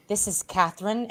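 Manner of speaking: put-on soft, slightly odd accent as Catherine; hushed alter ego, hint of odd accent; strange unplaceable accent. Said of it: "speaking in some sorts of strange".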